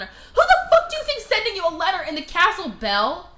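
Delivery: loud, high, incredulous rant; exasperated outburst. Retold or imitated. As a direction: tear into her with mock outrage.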